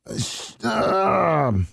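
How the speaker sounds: usual speaking voice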